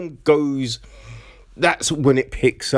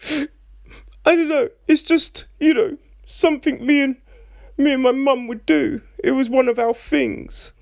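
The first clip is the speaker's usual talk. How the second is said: mock teary; 10 semitones higher than usual. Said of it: "And she's just like".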